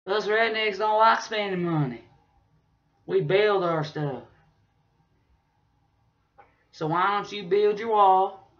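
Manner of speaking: usual speaking voice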